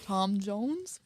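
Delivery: a hint of a Welsh singer; Welsh accent. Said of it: "Was that supposed to be a Welsh accent?".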